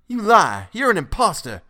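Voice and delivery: high, loud angry cry; furious accusation. A narrator's reading. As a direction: denounce him in a frenzy. Frantic father accuses the old man.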